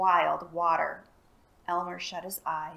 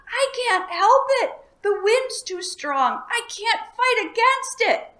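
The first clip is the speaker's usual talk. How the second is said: very high, loud panicked scream; dragon screaming; 10 dB louder than usual. A narrator's reading. Dragon screams to Elmer in the storm, high loud scream. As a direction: scream it against the wind. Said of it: "screamed the dragon".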